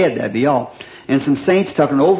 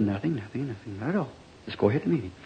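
softer, hushed nothing nothing; quietly hushing the son; hushed reply; 4 semitones lower, 12 dB quieter, faster than usual. Retold or imitated. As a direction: brush him off in a low hush.

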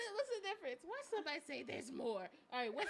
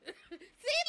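usual speaking voice

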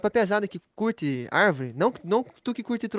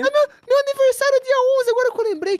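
high, louder excited outburst; sudden excited realization; 5 dB louder than usual. Real, not acted. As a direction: burst out remembering the birthday. Lembra aniversário de repente. (lembrança empolgada e aguda)

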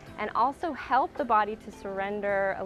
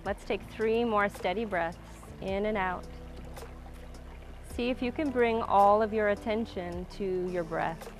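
calm grounding exercise. Acted. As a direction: guide the breath, calm and unhurried. Guides a slow breathing practice.

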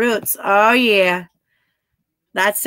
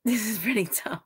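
whisper; 10 dB quieter than usual. Real, not acted. Aside while reading chat.

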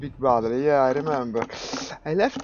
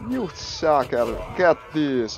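slow, louder mock-furious yell; shaking with anger; 5 semitones higher, 6 dB louder, slower than usual. Real, not acted. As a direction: snarl at the enemy. Yelling at the enemy cavalry.

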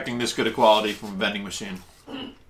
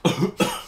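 coughing from wasabi; 5 semitones higher than usual. Real, not acted. Too much wasabi.

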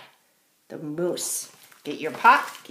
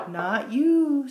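raised, drawn-out wind refusal; 7 semitones higher, 8 dB louder than usual. A narrator's reading. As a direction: draw it out like wind.

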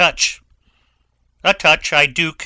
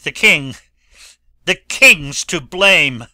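a louder, higher final cry; desperate accusation; 4 semitones higher, 9 dB louder than usual. Acted. Dying Laertes to Hamlet, crying out against the king. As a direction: force out the blame with the last breath.

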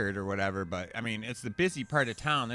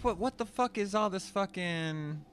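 higher, incredulous what-the-hell reaction; retelling his confusion with a pitched-up voice; baffled outburst; 7 semitones higher than usual. Retold or imitated. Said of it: "I'm like".

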